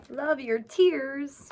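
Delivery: usual speaking voice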